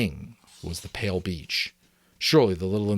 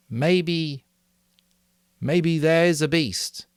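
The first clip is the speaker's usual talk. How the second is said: put-on higher, slow, hesitant boy; timid Simon; 9 semitones higher, 4 dB louder, slower than usual. A narrator's reading. Simon to the hostile assembly, slow and hesitant. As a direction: push the words out shyly.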